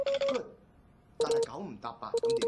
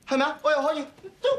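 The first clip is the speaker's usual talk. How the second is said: usual speaking voice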